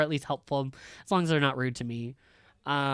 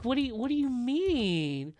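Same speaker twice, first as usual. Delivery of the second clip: incredulous; 8 semitones higher than usual. Real, not acted. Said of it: "incredulous mock question".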